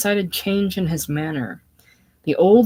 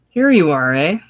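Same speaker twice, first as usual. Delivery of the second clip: hearty; 9 dB louder than usual. Acted. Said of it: "authoritative note in his speech".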